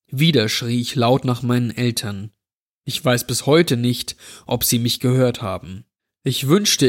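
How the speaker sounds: ordinary delivery, nothing marked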